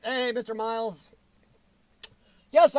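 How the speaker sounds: ordinary delivery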